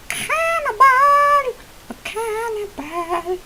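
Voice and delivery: very high, slow, hammed-up cry; mock outrage. Teasing his dog for chewing itself, very high mock outrage. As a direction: stretch it out in silly shock.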